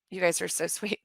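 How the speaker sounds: usual speaking voice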